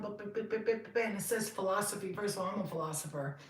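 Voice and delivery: usual speaking voice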